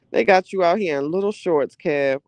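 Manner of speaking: ordinary delivery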